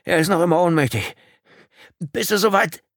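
high, loud, strained call. A narrator's reading. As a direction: shout down to the man below. Calling down from wreck while straining.

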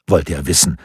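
usual speaking voice

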